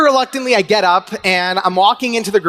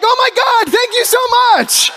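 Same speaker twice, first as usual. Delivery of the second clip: high, loud squealing thanks; thrilled fan; 4 dB louder than usual. Retold or imitated. Fan thanking Larry King, high thrilled squeal. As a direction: gush like a fan who just won.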